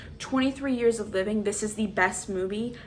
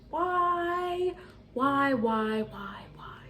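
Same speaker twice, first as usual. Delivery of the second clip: despairing whine; 6 semitones higher, slower than usual. Real, not acted. Despairing over the film.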